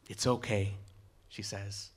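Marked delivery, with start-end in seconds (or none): 0.1-0.8 calm a panicking partner gently; reassuring. A narrator's reading. Girl reassures the panicking boy.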